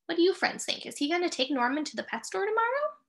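ordinary delivery

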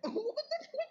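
high-pitched burst of laughter. Real, not acted. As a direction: break into a squeal of laughter. Laughs at the cloth remark. (ऊँची आवाज़ में ठहाका)

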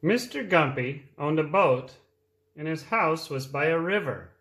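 reading a picture book aloud; lively read-aloud for small children; storytime voice. A narrator's reading.

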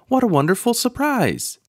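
ordinary delivery

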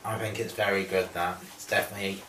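talking while chewing food; words around a mouthful; mouth partly full, chewing. Real, not acted. Seen 3: left man chews pursed-lipped after eating; speaker unsure.

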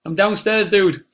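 loud, high call up the stairs; yelling across the room. Real, not acted. Calling upstairs to crying son.

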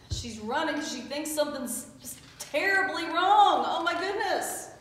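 loud storybook alarm; alarmed telling. A narrator's reading. Reader telling of mama rushing in, mock alarm. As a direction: build up to mock panic.

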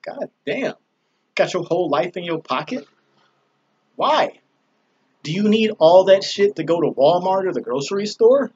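exasperated lecture. Retold or imitated. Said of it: "I'm like".

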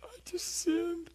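put-on high, slow childlike voice; pitiful kid voice confessing; little kid confession. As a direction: say it like a small broken child. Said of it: "I'm like a little kid".

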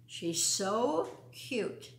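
usual speaking voice

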